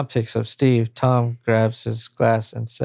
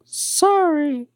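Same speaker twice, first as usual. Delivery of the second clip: put-on much higher shy girl voice; young woman voice. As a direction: act bashful and girlish. Liz apologises shyly.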